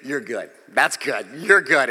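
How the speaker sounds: low, soft panting relief; mock out-of-breath thanks, dropping low; acting winded, dying. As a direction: gasp it out as if spent from running. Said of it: "and I'm dying".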